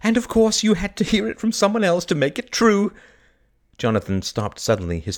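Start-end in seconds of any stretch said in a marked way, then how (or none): 0.0-2.9 high-pitched, elated exclamation; joyful teasing. A narrator's reading. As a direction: tease him in happy relief. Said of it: "Kay laughed".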